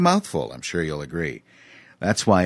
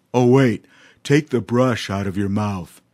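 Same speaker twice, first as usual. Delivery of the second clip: louder, realizing elephant; 5 dB louder than usual. A narrator's reading. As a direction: catch on suddenly. Voices Dumphy.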